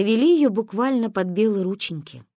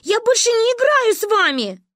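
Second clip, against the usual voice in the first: high, loud tearful wail; crying; 6 dB louder than usual. A narrator's reading. Narrator as child scolding sister and friend, crying. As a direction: wail at both of them in mock tears. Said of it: "заплакала я".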